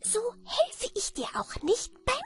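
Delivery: very high, whispered goblin threat; venomous sprite. A narrator's reading. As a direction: hiss the threat spitefully. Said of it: "fragte das Männchen giftig".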